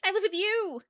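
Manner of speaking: put-on high, loud kid's comeback; loud, high-pitched mock protest; imitating oldest son. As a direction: mimic your son's pointed retort. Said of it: "he looks at me, he's like".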